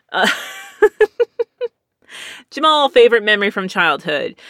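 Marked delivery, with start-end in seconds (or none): 0.8-1.7 high-pitched burst of laughter. Real, not acted. Laughs at own joke.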